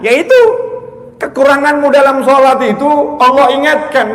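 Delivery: loud, high, insistent sermon voice; impassioned preaching. Acted. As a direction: drive each phrase home to the congregation. Preaches at full force.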